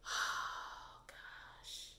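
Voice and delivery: breathy whispered "oh gosh"; a fed-up hushed aside; exasperated whisper. Real, not acted. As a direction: whisper it in disbelief.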